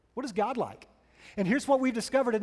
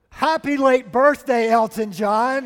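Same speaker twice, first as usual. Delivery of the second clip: nervous, too loud; 3 semitones higher, 10 dB louder, slower than usual. Retold or imitated. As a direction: bellow it slowly, as a flustered fan who lost volume control. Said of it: "the volume of her voice starts to grow".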